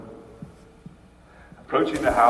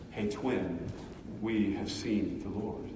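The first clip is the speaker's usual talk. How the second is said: gentle telling; 5 semitones lower, 11 dB quieter than usual. Acted. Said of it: "Mary's message became theirs".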